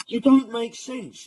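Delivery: loud and high, fed up; angry shout. Real, not acted. Telling the tweeter off, angry loud shout. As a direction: yell it straight at him.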